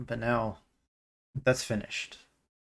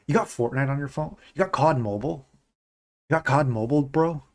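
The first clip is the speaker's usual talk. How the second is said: slightly higher, lightly voiced gym bro; 4 semitones higher than usual. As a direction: toss it off like a buddy at the gym. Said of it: "I'm sounding like a gym bro when I say it".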